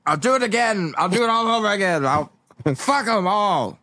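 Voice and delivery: high-pitched hammed-up tantrum; defiant son. Defiant son in acted quarrel.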